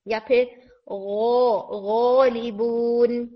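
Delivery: गौलिबून said very slowly, ghain voiced from the throat; slow tajweed demo. Shown as a demonstration. Teacher slowly demonstrating the ghain word. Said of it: "फिर इसी तरह से".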